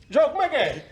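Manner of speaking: hammed-up mother calling him back; imitating mom calling across the room. Mother calls Joe back again.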